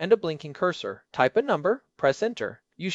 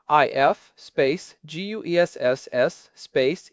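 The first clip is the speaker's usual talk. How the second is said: slow spelling out; slower than usual. Shown as a demonstration. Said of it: "where the structure really matters".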